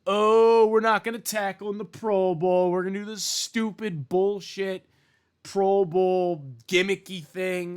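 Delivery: high, loud sneering parody of the league; mocking quote. Vlogger mocks Pro Bowl organizers, high sneering parody. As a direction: ham up the whiny officials. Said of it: "Enough of this".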